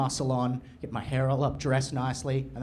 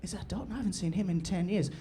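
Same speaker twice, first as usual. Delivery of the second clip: much softer, higher astonished murmur; stunned bully; 3 semitones higher, 9 dB quieter than usual. Retold or imitated. James spotting him across the room, soft stunned murmur. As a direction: gasp it out under your breath. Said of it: "he's gonna go".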